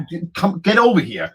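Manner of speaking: retold calling a friend over; urgent call.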